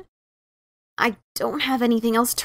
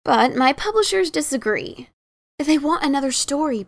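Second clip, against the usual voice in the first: plain reading voice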